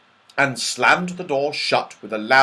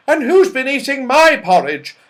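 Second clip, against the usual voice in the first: much higher and louder, stressing who's; a fussy mother bear complaining; 14 semitones higher, 10 dB louder than usual. A narrator's reading. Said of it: "said the mother bear".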